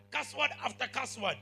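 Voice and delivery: ordinary talk, nothing marked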